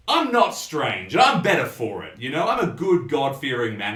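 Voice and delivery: loud, self-righteous rant; hollered barfly tirade; ranting drunk. Acted. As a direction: proclaim your virtue to the whole room. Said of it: "He just launches into this rant".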